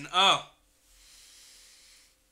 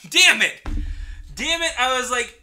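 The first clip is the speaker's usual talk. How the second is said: frustrated outburst; 7 semitones higher, 11 dB louder than usual. Real, not acted. Outburst at the rejection.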